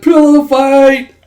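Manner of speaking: high, loud, drawn-out whoop; stretched-out excited yell; gleeful shout. Retold or imitated. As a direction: holler it like a battle cry. Acted excited shout at sleepover.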